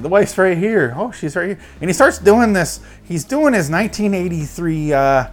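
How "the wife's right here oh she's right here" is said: higher, frantic Nick Cage quote; crazed Nick Cage. Retold or imitated. Retelling Cage going crazy upstairs, frantic and high. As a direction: play Cage unhinged and pitched up. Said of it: "just like".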